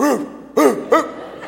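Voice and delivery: high, breathy mock barks; short comic dog woofs; dog impression. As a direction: woof like a dog. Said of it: "I'm a dog".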